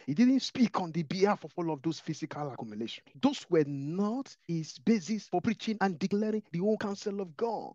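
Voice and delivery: loud, high, building sermon climax; full-force preaching. Acted. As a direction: preach it out with rising fire.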